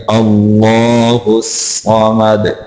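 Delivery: अल्लाहुस with long held "laa"; slow, drawn-out अल्लाहुस; lengthened Allah. Shown as a demonstration. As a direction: stretch the second vowel of अल्लाहुस, intoned. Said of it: "लफ्ज़ अल्लाह को लंबा करेंगे".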